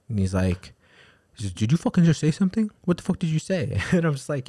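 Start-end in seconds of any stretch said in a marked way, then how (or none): 1.4-3.8 mock hostile snap. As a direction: throw the guy's aggression back at him. Retelling the guy's hostile reaction.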